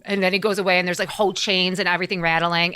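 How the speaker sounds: plain reading voice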